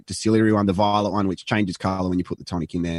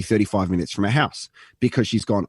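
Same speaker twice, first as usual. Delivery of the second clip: ordinary delivery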